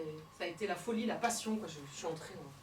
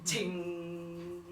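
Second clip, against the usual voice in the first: echo ting; 4 semitones lower, much slower than usual. Acted. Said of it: "un écho lointain".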